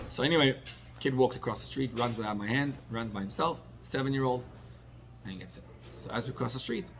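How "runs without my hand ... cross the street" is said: usual speaking voice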